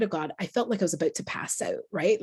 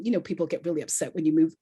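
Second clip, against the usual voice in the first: usual speaking voice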